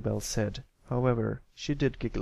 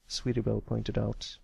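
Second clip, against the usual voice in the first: ordinary delivery, nothing marked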